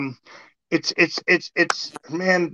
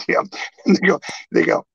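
normal talking voice

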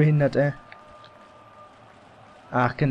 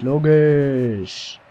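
usual speaking voice

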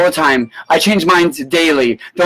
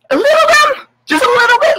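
mocking tiny voice, much higher; taunting squeak. Real, not acted. Vlogger taunting liberal viewers in a squeaky mocking voice. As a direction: tease them in a squeaky sing-song.

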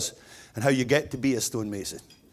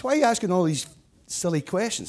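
higher, exasperated dad voice; a puzzled dad, pitched up; exasperated father; 6 semitones higher than usual. Acted. Father bear in joke.

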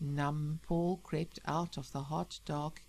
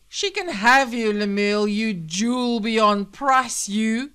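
loud, higher-pitched sarcastic tirade; scornful fury; 4 semitones higher, 13 dB louder, slower than usual. Acted. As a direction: spit out the endearments as insults. Wife berates husband with sarcasm.

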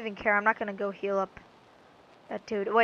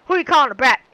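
indignant shout; 3 semitones higher, 12 dB louder, much faster than usual. Acted. Offended player yells back.

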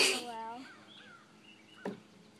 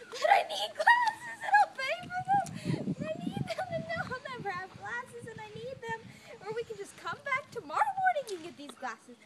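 high, loud whiny wail; whiny crying plea; 11 semitones higher, 9 dB louder than usual. Retold or imitated. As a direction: wail it like a kid denied. Retold whining to mom.

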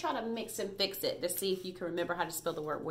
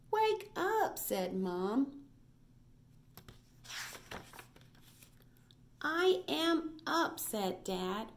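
lively read-aloud, quoted voices pitched higher; reading to children; 4 semitones higher, much slower than usual. A narrator's reading. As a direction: read the story to little kids, bright and sing-song. Reads picture book aloud.

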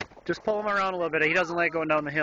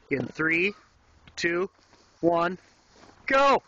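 countdown shout; much slower than usual. Real, not acted. Countdown to sled release.

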